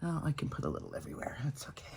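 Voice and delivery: breathy hushed whisper, quick. Real, not acted. Dabbing on makeup, whispering to herself. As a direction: whisper it to yourself while dabbing blush. Aside while applying makeup.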